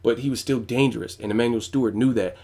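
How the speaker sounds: normal talking voice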